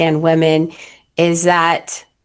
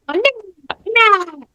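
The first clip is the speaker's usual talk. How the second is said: put-on high petulant little-child no; childish whine; 11 semitones higher, slower than usual. Fiancee refusing a hug, high childish whining. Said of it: "I act like a little child".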